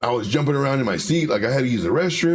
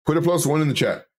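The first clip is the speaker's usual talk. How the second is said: ordinary talk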